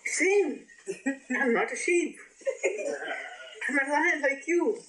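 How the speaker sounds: high, bright-eyed mock lion calling out; excited lion voice. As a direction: call out to the startled sheep. Lion shows sheep its reflection.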